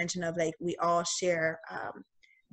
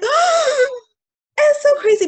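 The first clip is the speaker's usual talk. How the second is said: much higher and louder, overwhelmed amazement; amazed gasp; 11 dB louder, slower than usual. Retold or imitated. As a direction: gasp it out, stunned and moved. Moved reaction to uncle's words.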